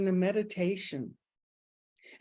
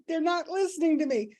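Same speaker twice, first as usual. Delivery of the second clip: much higher, indignant complaint; whiny high protest; 9 semitones higher than usual. Retold or imitated. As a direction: sound wronged and ignored. Ego voice, high pitch.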